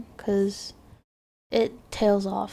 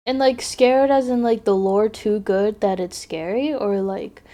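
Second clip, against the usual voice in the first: ordinary talk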